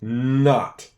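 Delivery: Not with a long, buzzing n at the start; teacher sounding out the first letter of Not. Shown as a demonstration. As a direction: stretch the n so the class hears it. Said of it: "can you hear the first letter?".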